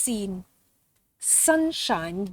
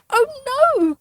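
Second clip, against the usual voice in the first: much higher, louder cry of alarm; 14 semitones higher, 6 dB louder than usual. Acted. Mouse seeing the angel tumble, loud alarmed cry. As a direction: cry out as it falls. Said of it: "cried Daisy".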